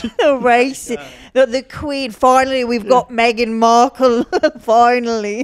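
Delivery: loud, high mock British exclaiming; aggrieved Brit. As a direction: celebrate as a hammed-up Brit.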